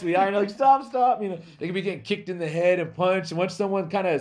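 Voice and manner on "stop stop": mock panicked plea. Fighter begging after eye poke.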